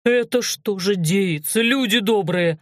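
slow, drawn-out lament; mildly hammed-up peasant keening; wailing. A narrator's reading. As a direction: wail in scandalized dismay. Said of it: "заголосил".

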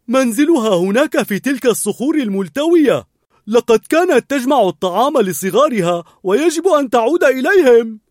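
high, loud, goofy dog voice; an eager, friendly dog explaining; eager talking dog. A narrator's reading. Narrator voices Dug the dog.

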